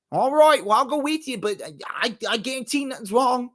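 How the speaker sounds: high, hammed-up Australian accent. Foster worker agreeing to go along, mock Australian accent. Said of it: "my Australian".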